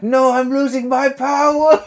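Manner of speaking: high, loud mock-desperate cry; hammed-up cry of a failing superhero; pretend panic. Gamer playing Spider-Man losing his powers, mock panic. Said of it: "pretend my spider powers were running out".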